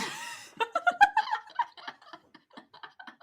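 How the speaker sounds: loud, high cackling laugh; cackle laugh. Real, not acted. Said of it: "sorry for the cackle laugh".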